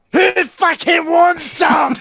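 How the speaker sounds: drawn-out, high-pitched bully's yell; mock challenge shout. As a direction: imitate a bully roaring for a fight. Chair-waving bully challenges the room.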